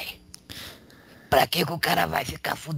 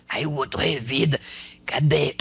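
ordinary delivery

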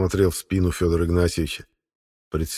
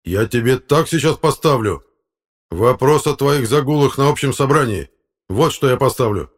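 loud, raised-pitch threat; threatening; 6 semitones higher, 6 dB louder than usual. Acted. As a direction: lay down a threat as the angry boss. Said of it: "с угрозой сказал Фёдор Игнатьевич". (громко, угрожающе)

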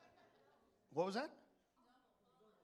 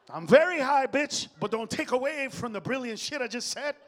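loud defiant reply; 4 semitones higher, 7 dB louder than usual. Retold or imitated. Comic answers Randy, mock-defiant.

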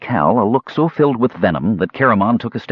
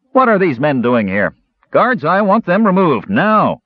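high, forceful lordly command; 9 semitones higher, 4 dB louder than usual. A narrator's reading. Lord ordering guards to remove intruders, raised commanding voice. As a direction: order the guards sharply, pitch well up. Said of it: "Lord Alvin commanded".

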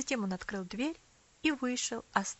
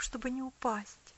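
ordinary delivery

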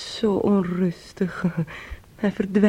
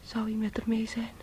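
unvoiced, anxious whisper; 8 dB quieter than usual. Niece whispering her fear to the aunt. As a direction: breathe the question fearfully.

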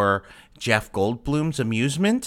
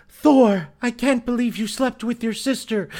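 high-pitched hammed-up accusation; mock soap outrage; 12 semitones higher, 3 dB louder than usual. Soap character confronting Thor over a sister, mock-scandalized. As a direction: cry the name loud, then stay high. Parody soap opera quarrel.

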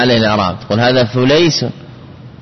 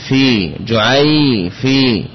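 جعي في split into drawn-out syllables; slow syllable demo; much slower than usual. Shown as a demonstration. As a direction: break جعي في apart slowly for students. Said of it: "فتقول جعي".

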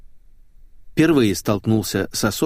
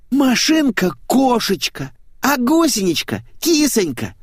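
moaning; 3 dB louder than usual. A narrator's reading. Blonde explains car and caterpillar names, moaning. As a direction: moan each pet name, pitched way up. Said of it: "простонала Светик".

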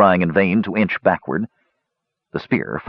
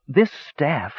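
shaken, softly strained; understated tremble of shock; low, shaking voice; 8 semitones higher, 3 dB quieter than usual. A narrator's reading. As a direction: say it as if badly shocked. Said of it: "in a low, shaking voice".

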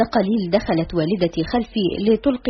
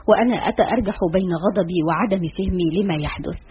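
usual speaking voice

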